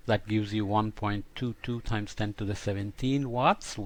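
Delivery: ordinary delivery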